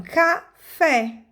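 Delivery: "café" split, with a long stressed "fé". Shown as a demonstration. Teacher marking the tonic syllable for pupils, "café" with held FÉ. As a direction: draw out the last syllable of "café". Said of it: "Fé é a sílaba tônica".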